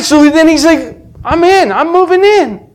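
very high, exultant proclamation; impassioned cry, pitch soaring; fervent preaching. Real, not acted. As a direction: proclaim it at the peak of the sermon. Preaches the climax of conversion.

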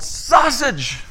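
high-pitched comic yell. Retold or imitated. Pastor yells his order back to assistant, yelled. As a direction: imitate a boss yelling back the punchline. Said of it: "and he yelled".

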